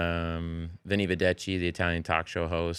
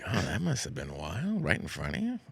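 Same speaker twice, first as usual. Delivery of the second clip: hushed, creepy, fast true-crime host; soft ominous Dateline narrator; Keith Morrison; 6 dB quieter than usual. Retold or imitated. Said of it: "he's like".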